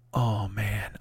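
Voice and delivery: low, soft, defeated aside; muttered to himself. Retold or imitated. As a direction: say it under your breath, to yourself. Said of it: "that's to me".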